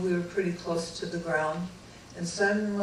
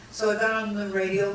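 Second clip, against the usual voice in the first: normal talking voice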